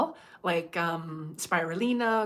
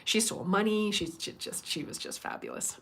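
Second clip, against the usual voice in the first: usual speaking voice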